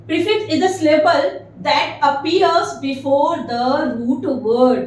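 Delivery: very slow, word by word; slow dictation. Shown as a demonstration. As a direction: read it out for students to copy. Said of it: "dictating a definition slowly".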